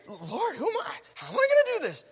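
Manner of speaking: high, whiny, fearful plea; scared whining Gideon. Acted. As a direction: whimper it like a frightened man pleading with God. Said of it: "does this sound like a mighty man of valor?".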